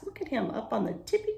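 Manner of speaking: ordinary delivery, nothing marked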